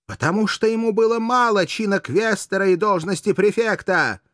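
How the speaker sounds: high, emphatic accusation; impassioned exclaiming; indignant tirade. A narrator's reading. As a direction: hammer the accusation home. Courtesan continues her tirade.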